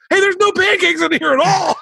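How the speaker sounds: very high mock protest; squeaky crowd complaint; protesting bar crowd.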